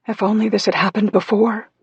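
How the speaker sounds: put-on high, breaking voice like a whining child; broken, high voice. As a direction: let the voice crack up high, near tears. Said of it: "Her voice sounded broken and high".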